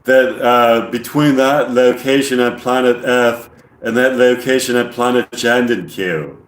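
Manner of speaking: put-on amateur English accent, deliberate alien monotone. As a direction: imitate an English alien explaining, evenly paced. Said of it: "It's not the best English accent".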